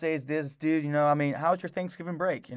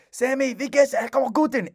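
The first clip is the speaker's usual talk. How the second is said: high, raised mock-German dog call; loud German command; 10 semitones higher, 3 dB louder than usual. Older man summoning his dog in German, loud high-pitched command. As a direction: imitate calling a dog loudly in German. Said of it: "in German loud".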